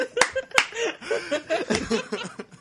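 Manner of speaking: high falling burst of laughter. Real, not acted. Cracking up at the silent granola bar, high laugh. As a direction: let a long high laugh tumble down.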